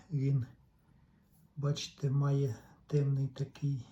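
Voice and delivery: ordinary talk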